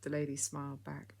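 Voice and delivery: usual speaking voice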